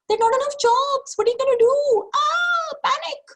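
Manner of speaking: high, loud, shrieking panic; shrill panicking scarcity. Acted. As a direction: shriek it like the world is ending. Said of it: "scarcity is always loud and shrill and shrieking".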